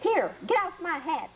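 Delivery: a mild mock cry of annoyance; old woman crying out. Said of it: "the woman cried".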